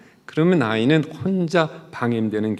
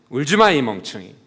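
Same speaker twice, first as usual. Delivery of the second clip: scolding mother; 2 semitones higher, 5 dB louder, faster than usual. Acted. Mother yelling at her crying son, harsh and loud. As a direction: snap at the crying child in disgust. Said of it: "그렇게 한단 말이에요".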